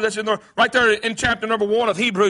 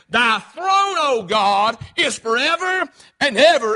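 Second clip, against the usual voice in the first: proclaimed scripture; 2 semitones higher, slower than usual. Acted. Pastor reading Hebrews aloud, ringing out. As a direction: declare it like a herald.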